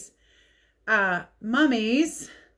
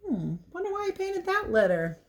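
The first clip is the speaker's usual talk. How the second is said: ordinary delivery, nothing marked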